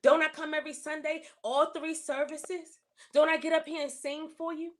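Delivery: high, aggrieved questions thrown at God; retold plea to God. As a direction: raise the pitch and protest to God. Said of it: "retold complaint to God".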